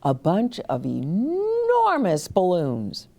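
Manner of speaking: ordinary delivery, nothing marked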